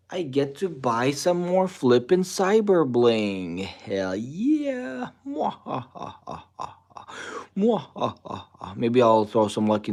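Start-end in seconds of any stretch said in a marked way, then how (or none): 0.1-5.1 rising gleeful hype, slow and loud; gloating. Real, not acted. Gloating over buying more crypto. 5.2-8.7 mad scientist laugh. Pretend. Mock villain laugh after buying.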